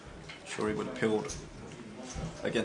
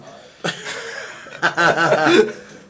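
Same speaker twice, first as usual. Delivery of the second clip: loud laugh; 7 semitones higher, 10 dB louder than usual. Real, not acted. Laughing at the joke.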